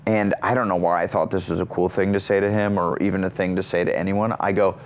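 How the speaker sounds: usual speaking voice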